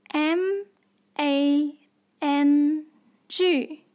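usual speaking voice

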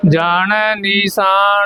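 ਨੀਸਾਨ with a plain s, not sh; ਨੀਸਾਨ ਪੋਲਾ, ਸ ਬਿਨਾਂ ਬਿੰਦੀ; correct pronunciation. Shown as a demonstration. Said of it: "ਨੀਸਾਨ ਕਹਿਣਾ, ਨਿਸ਼ਾਨ ਨਹੀਂ ਕਹਿਣਾ".

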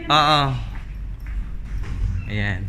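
ordinary delivery

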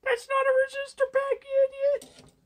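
squeaky high mock commenter, normal volume. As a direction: needle yourself in a shrill troll voice. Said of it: "Someone is probably typing in the comments".

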